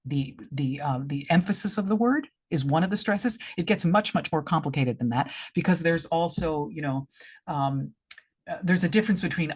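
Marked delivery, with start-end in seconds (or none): none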